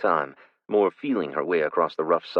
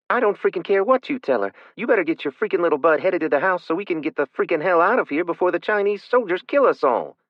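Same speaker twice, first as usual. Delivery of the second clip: high, loud and rushed fury; furious yelling; 11 semitones higher, 6 dB louder than usual. A narrator's reading. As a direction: fire it off fast, losing patience. Big sister scolding hidden child.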